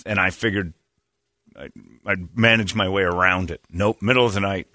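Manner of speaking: ordinary delivery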